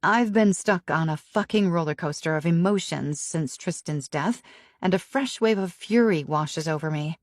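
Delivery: usual speaking voice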